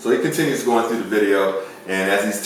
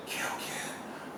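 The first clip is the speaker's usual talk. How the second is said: whispered, spooky and breathless; ghostly whisper; 19 dB quieter than usual. Retold or imitated. Ghost on the tape threatens Ken, in a whisper. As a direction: breathe it like a voice from nowhere.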